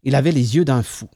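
ordinary delivery, nothing marked

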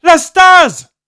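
high, loud, alarmed warning; mother shouting a warning; urgent warning. A narrator's reading. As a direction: yell the danger at him. Mother warns son, running.

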